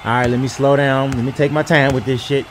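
ordinary talk, nothing marked

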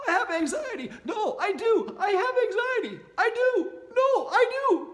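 mock someone clinging to their anxiety; whiny insistence. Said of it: "you keep having that thought".

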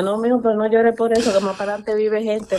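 ordinary delivery

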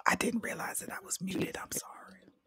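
soft unvoiced whisper. Real, not acted. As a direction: barely whisper the apology. Just unmuted, speaking softly.

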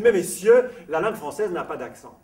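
loud, high outburst on "Mais messieurs"; indignant retort. Retold or imitated. Romain Rolland answers Strauss, mock-indignant proclamation. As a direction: protest grandly, then lay down the rule.

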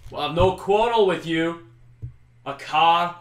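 high, loud call across a battlefield; shouting. Acted. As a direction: shout it out to the enemy leader. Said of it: "He shouts out".

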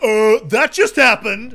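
mock achievement quip, loud and drawn-out; slow, hammed-up delivery of a cheesy quip. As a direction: overplay the corny catchphrase. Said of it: "an achievement that's like".

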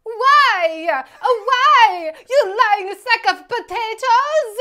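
high, very loud mock Italian wail; hammed-up Italian shout. Re-enacting Leto's scene in a screaming Italian accent. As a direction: bellow it like an overwrought Italian. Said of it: "Same scene".

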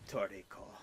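lightly attempted Japanese accent on Toriko. Shown as a demonstration. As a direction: say Toriko in three syllables, Japanese-style. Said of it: "if I had the accent, it'd be like".

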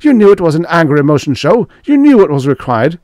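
fast, high, loud accusation; furious cry. A narrator's reading. Stark at the phone screen, crying out angrily. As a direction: hurl it at him, rushed and high. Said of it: "he cried".

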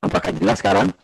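glitchy robotic voice over a bad connection; distorted, robotic video-call audio. Real, not acted. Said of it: "Suaranya kayak robot jadinya".

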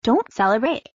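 usual speaking voice

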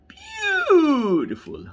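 long, high, swooping "Beautiful"; drawn-out delighted coo; gushing admiration. Real, not acted. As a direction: stretch it out, high and loud, then drop. Admiring the new car.

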